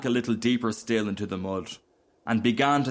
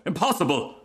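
higher, incredulous rejection; indignant disbelief; 6 semitones higher than usual. Acted. Rocket rejecting the boy's insult, mildly indignant. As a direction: refuse to believe the slight.

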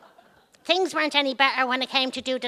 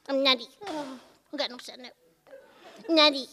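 ordinary delivery

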